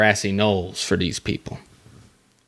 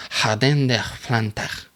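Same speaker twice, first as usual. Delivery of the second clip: Jardin des Plantes with a Spanish j, slowly; mock Spanish accent; 2 semitones higher, slower than usual. Reading the French name in a mock Spanish accent. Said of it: "I'm from Spain".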